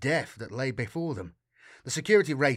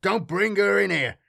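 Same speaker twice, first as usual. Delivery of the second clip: raised, louder and higher warning; urgent call; 6 semitones higher, 6 dB louder than usual. A narrator's reading. Matthias calls to the others as they approach, urgent shout. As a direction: call out to stop them. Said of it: "Matthias called".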